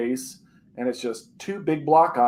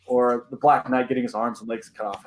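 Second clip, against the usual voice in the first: ordinary talk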